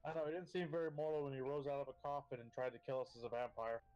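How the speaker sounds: usual speaking voice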